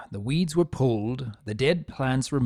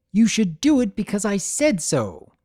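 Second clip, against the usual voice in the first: higher, grating, bossy filly; 8 semitones higher, 4 dB louder than usual. A narrator's reading. Bossy older filly ordering her brother, grating higher tone. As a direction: lord it over your brother. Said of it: "a tone of voice that just grated".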